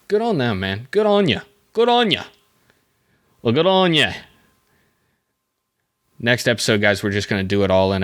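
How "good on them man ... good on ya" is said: put-on Australian accent, pitched high. Cheering on the lawn care guys, mock Australian accent. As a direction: try out a playful Australian lilt, high then falling. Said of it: "is that an Australian accent that I just did?".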